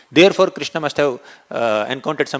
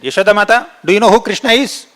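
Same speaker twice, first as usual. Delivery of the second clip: bold challenge; 6 semitones higher, 7 dB louder than usual. A narrator's reading. Balram challenges Yashoda boldly.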